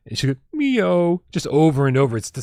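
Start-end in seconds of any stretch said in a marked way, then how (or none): 0.5-1.2 high, loud imitation cat wail; plaintive mimicked meow of a sad cat; heartbreaking meow. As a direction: meow like a miserable cat in a carrier. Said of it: "saddest, most heartbreaking meow you've ever heard".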